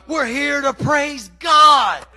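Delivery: loud, slow fiery preaching. Acted. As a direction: shout it like a revival preacher. Preaching at full force.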